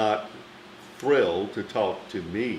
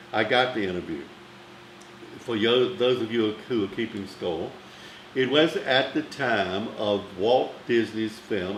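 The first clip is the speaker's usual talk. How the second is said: normal talking voice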